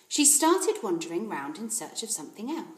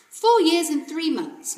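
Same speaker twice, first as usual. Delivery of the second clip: higher, slow and careful answer; proud little girl; 8 semitones higher, 10 dB louder, slower than usual. Acted. Matilda telling the librarian her age, slow and careful. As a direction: answer proudly like a small child. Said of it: "Matilda said".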